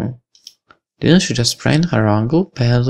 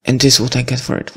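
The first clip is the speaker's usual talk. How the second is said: ordinary talk, nothing marked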